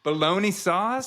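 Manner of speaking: can't believe the phrase; incredulous. Real, not acted. Incredulous repeat.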